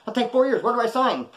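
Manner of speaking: high, eager plea to take the deal; mock eager pleading. Prisoner begging the court for the four years, high and eager. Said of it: "He's like".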